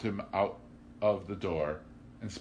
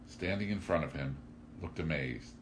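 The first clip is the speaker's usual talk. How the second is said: ordinary delivery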